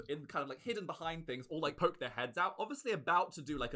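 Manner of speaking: ordinary delivery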